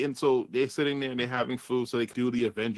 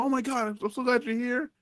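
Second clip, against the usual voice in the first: much higher nervous sucking-up voice; fawning Paradox; 8 semitones higher than usual. Retold or imitated. Paradox explains himself to the boss, high fawning babble. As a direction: gush to the boss in a high panicky voice. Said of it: "He's like".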